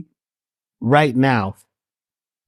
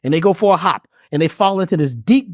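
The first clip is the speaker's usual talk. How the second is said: ordinary talk, nothing marked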